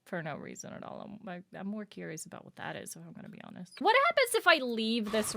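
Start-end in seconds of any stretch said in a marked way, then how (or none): none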